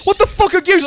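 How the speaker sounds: very high, loud panicked yell; frantic shout. Retold or imitated. Gamer screaming at friend during boss fight, high and loud. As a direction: scream it in total confusion. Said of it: "we just start shouting".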